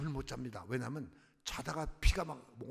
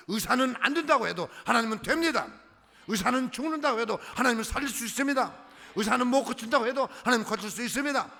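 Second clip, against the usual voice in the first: loud, higher, ringing sermon refrain; full-force preaching; 7 semitones higher, 8 dB louder than usual. Real, not acted. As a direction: hammer each contrast like a preacher.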